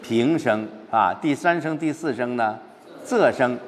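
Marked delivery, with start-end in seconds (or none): none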